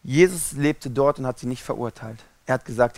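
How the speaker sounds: ordinary talk, nothing marked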